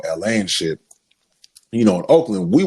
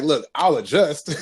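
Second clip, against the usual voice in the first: laughing concession; 6 semitones higher, slower than usual. Retold or imitated. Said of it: "it's like".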